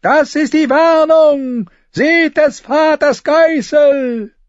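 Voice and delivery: high, loud, drawn-out prophetic shriek; shrill scream. A narrator's reading. Begging brother points the crowd to the comet, shrill scream. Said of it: "schrie der Mann schrill".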